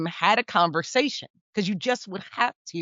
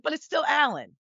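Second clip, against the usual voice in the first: a higher, lighter inner voice; musing fondly to oneself, pitched up; lightly voiced inner thought; 9 semitones higher than usual. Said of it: "But I was like".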